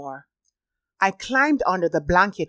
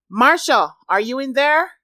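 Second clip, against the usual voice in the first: calling out; 6 semitones higher, 5 dB louder than usual. A narrator's reading. Calling through door.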